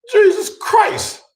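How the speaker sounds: slow, high, drawn-out exasperation; fed-up groan of words; exasperated. Retold or imitated. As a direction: sigh it out to the heavens. Exasperated outburst.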